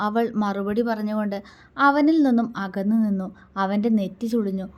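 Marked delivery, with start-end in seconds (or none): none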